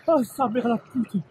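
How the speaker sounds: high, slow cold-shock swearing; in ice cold water. Real, not acted. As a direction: swear through the icy shock. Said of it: "Elle est gelée".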